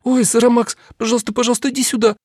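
high wailing plea; wailing princess. A narrator's reading. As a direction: wail for help from the ceiling. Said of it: "взвыла".

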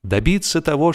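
ordinary delivery, nothing marked

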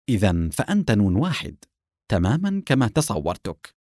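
plain reading voice

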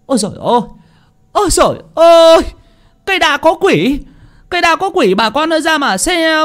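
frantic high shouts building to a scream; shouting loudly. Acted. Villager running home, yelling to neighbours in panic. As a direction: scream for the whole village to come look. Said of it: "hô to".

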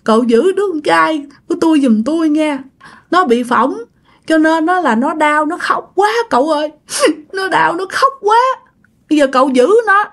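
high-pitched tearful begging; sobbing mother, acted with sniffles; crying while talking. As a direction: plead in a high weepy voice. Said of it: "Mà vừa nói vừa khóc".

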